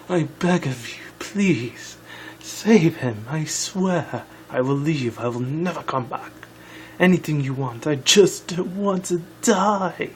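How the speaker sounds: pleading changeling queen, badly faked; rough impression of the begging queen. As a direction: beg for your life as a hammed-up queen. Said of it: "that's my shitty impression of her".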